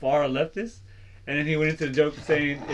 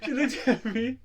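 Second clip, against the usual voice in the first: higher, breaking into laughs while talking; laughing through the words; 8 semitones higher, slower than usual. Real, not acted. Friend cracking up while telling a work story, laughing through it.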